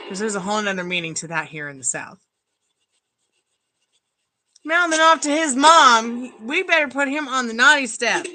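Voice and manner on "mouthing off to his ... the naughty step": mock scolding. Mocking a son who mouths off to his mom, playful scolding. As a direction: scold him like a kid off to the naughty step.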